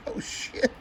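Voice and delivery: whispered curse under the breath; breathless curse. Real, not acted. Finger still stinging, whispered curse. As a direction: hiss it as the pain flares.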